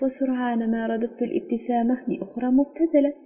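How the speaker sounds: plain reading voice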